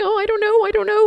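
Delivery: very high, frightened whine; scared whimper. Retold or imitated. Sister refusing to go up the trail, high and frightened. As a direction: mimic the little sister's fear.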